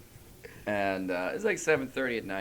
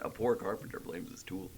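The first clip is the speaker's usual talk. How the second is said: muttered mock retort; 5 semitones lower, 12 dB quieter than usual. Jesus muttering a retort, acted.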